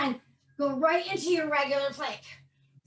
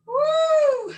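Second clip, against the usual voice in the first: very high, loud cheer; excited whoop; 11 semitones higher, 7 dB louder than usual. Real, not acted. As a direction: shout it out joyfully. Trainer cheers mid-workout.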